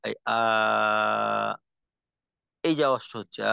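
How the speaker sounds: আ drawn long with the mouth open. Shown as a demonstration. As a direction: let আ flow without blocking. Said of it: "যেমন".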